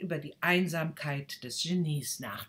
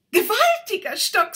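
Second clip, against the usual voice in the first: loud, much higher teasing; witty boy; 14 dB louder than usual. Acted. Boy jokes about the stick, loud and high. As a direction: tease the stick. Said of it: "sagte er gewitzt".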